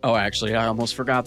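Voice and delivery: ordinary talk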